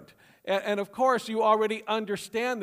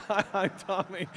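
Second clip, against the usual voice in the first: laughing repeat. Retold or imitated. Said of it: "repeat of punchline".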